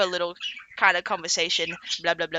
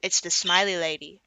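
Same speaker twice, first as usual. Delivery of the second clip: plain reading voice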